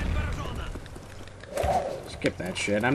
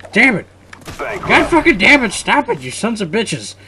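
raised, high-pitched cursing, easing slightly; exasperated rage; 7 semitones higher, 4 dB louder than usual. Real, not acted. Gamer losing points because of one guy, exasperated yelling. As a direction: yell in real frustration.

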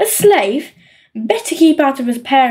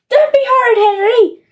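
much higher scolding call; scolding mum; 9 semitones higher than usual. A narrator's reading. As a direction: call out a warning like a mother. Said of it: "shouted your brother".

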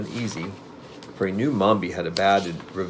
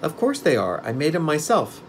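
put-on higher boy's voice with pride; proud; 7 semitones higher than usual. A narrator's reading. Boy claiming credit for the pumpkin man's legs, proud. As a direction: boast lightly in a raised pitch. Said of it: "returned the boy with pride".

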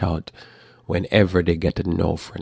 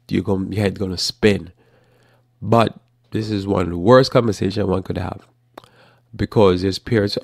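normal talking voice

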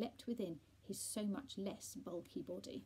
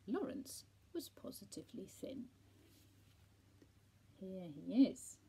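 ordinary delivery, nothing marked

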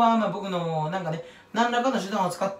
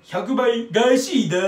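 drawn-out, louder, stranger take; stranger impression retry; 5 dB louder, much slower than usual. Vlogger retries the TV drama line, weirder and slower. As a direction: stretch the catchphrase out oddly. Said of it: "もうちょっと変な、変に言った方が面白い".

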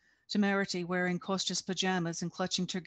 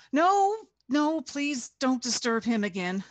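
the first no much higher and louder, then urgent pleading; near shout; 6 semitones higher, 7 dB louder than usual. A narrator's reading. Matvey begs the sergeant not to fetch Nikto, near shout. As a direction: nearly shout to stop him. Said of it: "Matvey almost shouted".

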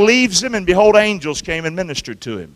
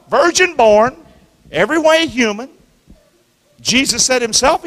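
raised, ringing preacher cry; full-force preaching; 7 semitones higher, 5 dB louder than usual. Acted. Preacher proclaiming Jesus to the church, loud and ringing. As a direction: proclaim it to the back pew.

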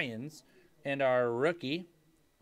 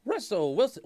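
an accidental voice crack; 6 semitones higher, 4 dB louder than usual. Real, not acted. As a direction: pitch cracks up on the name. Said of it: "Ooh, voice crack".

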